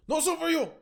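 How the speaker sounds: high, barked refusal; Soup Nazi impression. Cohost echoing the Soup Nazi in a high bark. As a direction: snap the refusal like a stern soup vendor. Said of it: "The soup Nazi".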